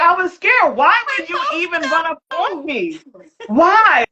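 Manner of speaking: high, pleading self-defense; defensive. Retold or imitated. Defending herself to boyfriend, high indignant protest. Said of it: "And I was like".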